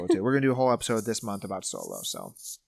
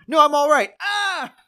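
high strained cry ending in a loud death scream; high, loud crash scream of a dying pilot; doomed pilot; 6 dB louder, slower than usual. Retold or imitated. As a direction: act out a pilot crashing, yelling. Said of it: "I'll say person one and person two".

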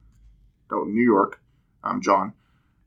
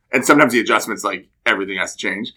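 normal talking voice

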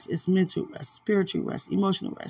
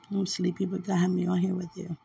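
usual speaking voice

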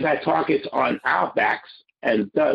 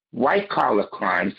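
ordinary talk

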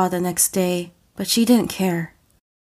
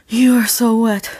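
panting; 3 semitones higher, 5 dB louder than usual. Acted. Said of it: "he panted".

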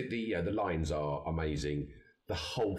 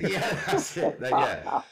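breathless high laugh-talk, louder; 7 semitones higher, 7 dB louder, slower than usual. Real, not acted. Friend loving the comment joke, laughing loud and high. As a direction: agree while laughing hard.

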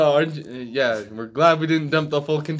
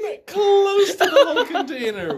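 laughing; 14 semitones higher, 3 dB louder than usual. Real, not acted. Friends cracking up at story.

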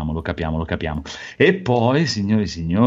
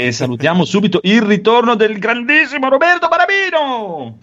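big, high show-host welcome; announcer voice; 10 semitones higher than usual. Real, not acted. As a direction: open the show like a presenter. Host's enthusiastic show opening. (voce da presentatore entusiasta)

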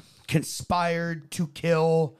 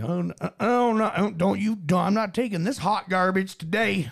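put-on higher, indignant Southern good ol' boy; Southern accent; 3 semitones higher than usual. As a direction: play a fed-up Southern hero. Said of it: "but he's Southern".

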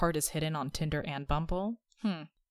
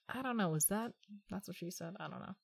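ordinary talk, nothing marked

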